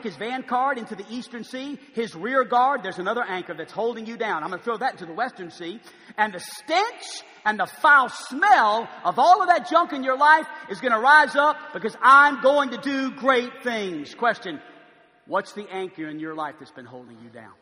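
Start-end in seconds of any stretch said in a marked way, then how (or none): 6.2-14.1 loud, slow, building sermon climax; preaching. Acted. Preacher promising the church a cleansing, booming. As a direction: drive it home to the crowd.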